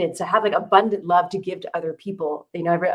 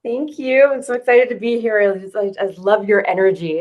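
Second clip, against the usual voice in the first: high, loud, bubbly thanks; excited; 4 semitones higher, 8 dB louder than usual. Real, not acted. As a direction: gush with excitement. Excited guest greets host.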